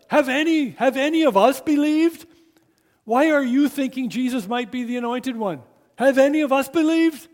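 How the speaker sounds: high, loud, indignant Pharisee; angry scolding. Acted. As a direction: rage at the gullible officers.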